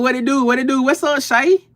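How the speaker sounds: ordinary delivery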